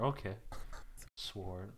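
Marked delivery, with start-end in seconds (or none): none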